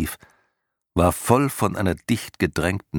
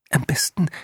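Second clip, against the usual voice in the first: high, chirpy tiny voice; chirping voice; 10 semitones higher than usual. A narrator's reading. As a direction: chirp it like a small bird. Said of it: "zwitscherte der Winzling".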